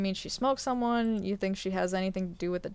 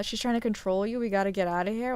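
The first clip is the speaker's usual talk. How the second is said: usual speaking voice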